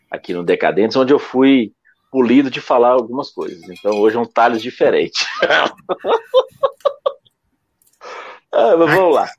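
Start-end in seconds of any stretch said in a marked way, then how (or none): 5.3-7.1 high-pitched giggling laugh. Real, not acted. Laughs after his joke.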